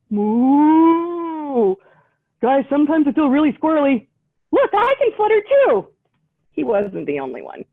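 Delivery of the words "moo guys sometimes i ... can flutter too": higher, fast, excitable critter; party animal. Acted. Animal guest boasting at the party, high and quick. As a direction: chatter eagerly to friends.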